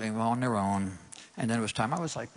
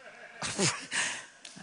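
laugh; 9 semitones higher, 4 dB quieter than usual. Real, not acted. Laughs after long sermon.

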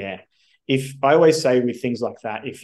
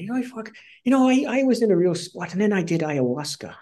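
high-pitched Irish impersonation; Irish mentor; 10 semitones higher than usual. Retold or imitated. Mentor confiding his ayahuasca trip, imitated Irish accent. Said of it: "he's Irish".

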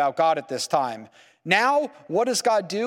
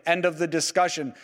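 ordinary talk, nothing marked

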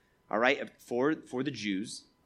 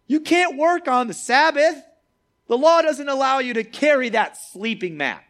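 high, loud officious objection; indignant scolding; 8 semitones higher, 7 dB louder than usual. Acted. Leaders telling off the healed man for carrying his mat, indignant scolding. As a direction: scold him like an outraged rule-keeper.

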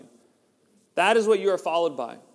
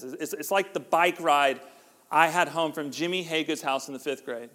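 usual speaking voice

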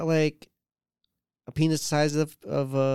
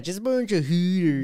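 higher, mock Canadian accent; 5 semitones higher than usual. Shown as a demonstration. Friend joining the bit in mock Canadian accent. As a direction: play along in exaggerated Canadian. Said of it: "you say the Canadian accent".

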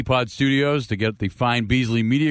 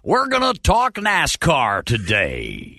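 slow, much higher, stretched-out radio greeting; old-timey radio announcer; 10 semitones higher, much slower than usual. Pretend. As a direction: boom it out like vintage radio. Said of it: "Like old-timey radio guys".

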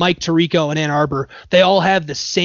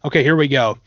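ordinary delivery

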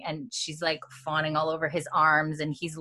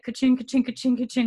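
higher-pitched repeated ka-ching; imitated coin jingle; 5 semitones higher than usual. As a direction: mimic coins spilling out of pockets. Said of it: "we hear, like".

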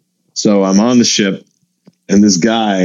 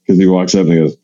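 ordinary talk

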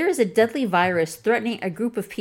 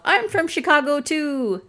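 much higher, delighted; beaming; 7 semitones higher than usual. Retold or imitated. Man telling her he's also from Chicago, beaming. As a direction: share the joy of home. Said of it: "he beamed".

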